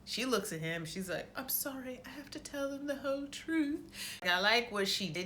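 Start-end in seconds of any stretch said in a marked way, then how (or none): 1.4-3.8 meek apology. Retold or imitated. Sansa addressing him before testifying, meek apology. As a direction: apologise in a small, lifted voice.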